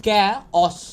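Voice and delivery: ক্যাওস split as "kay-os"; loud, two-beat ক্যাওস; correct pronunciation. Shown as a demonstration. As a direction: model ক্যাওস syllable by syllable. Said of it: "এর উচ্চারণ কী হবে".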